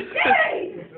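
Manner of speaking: high loud gleeful cheer; excited yay. Retold or imitated. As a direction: cheer like a delighted kid. Reacting excitedly.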